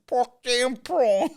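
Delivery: high, slow South African Pork damn prawn; thick South African accent, high pitched. Retold or imitated. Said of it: "He was saying".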